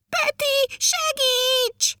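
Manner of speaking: very high squealed shout of a little elf; shrill, high cry for rescue; shouted cry for help. A narrator's reading. Little elf swept off by the wind calls to his friend, high-pitched shouting. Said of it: "kiabált Mütyür Manó".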